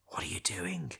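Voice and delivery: urgent low whisper; hissed whisper. Acted. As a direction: whisper it so the guards can't hear. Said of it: "hissed Alkland".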